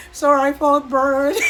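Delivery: loud mock accent, breaking into laughter; [name]'s put-on accent. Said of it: "What was that accent that [name] was doing".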